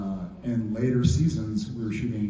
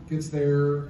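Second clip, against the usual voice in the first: ordinary talk, nothing marked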